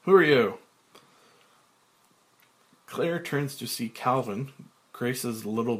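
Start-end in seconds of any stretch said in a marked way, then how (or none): 0.0-0.6 loud, hard demand in his own voice; Frank demanding. Acted. Frank confronting the hidden boy, loud and demanding. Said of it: "Frank, pointing over shoulder".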